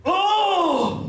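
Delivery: loud, high "oh" of sudden pain; pained cry. Acted. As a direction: cry out as a cramp hits your guts. Sudden gut pain while walking.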